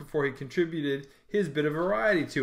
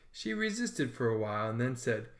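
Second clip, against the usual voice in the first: ordinary delivery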